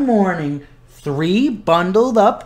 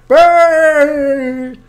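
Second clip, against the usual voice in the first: shivering brr; 8 semitones higher, 7 dB louder than usual. Pretend. It's getting cold out there.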